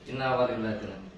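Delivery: softly chanted verses, fading away; murmured recitation. Acted. Healer closing the session with quiet recited verses.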